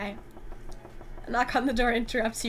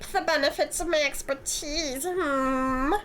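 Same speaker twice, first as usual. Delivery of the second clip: a lightly voiced smug, flirty antique dealer; drawn-out, teasing greeting with a slight edge; smug [name]; 4 semitones higher, slower than usual.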